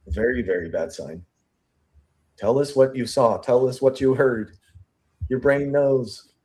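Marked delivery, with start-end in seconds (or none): 2.4-6.3 coaxing investigator. Retold or imitated. Investigator urging the witness to recall, coaxing. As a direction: press her gently to remember.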